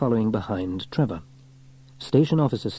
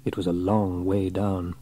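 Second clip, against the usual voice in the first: usual speaking voice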